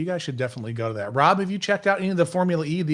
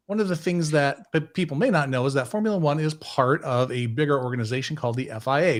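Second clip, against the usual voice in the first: ordinary talk, nothing marked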